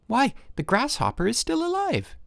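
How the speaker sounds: put-on boy amazed at his insect, high and surprised; in surprise. A narrator's reading. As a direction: marvel at it like a small boy. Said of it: "said Petya in surprise".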